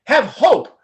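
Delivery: put-on loud, slow Nigerian accent blessing. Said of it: "another Nigerian accent".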